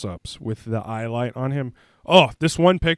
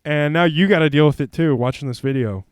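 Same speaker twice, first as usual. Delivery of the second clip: ordinary talk, nothing marked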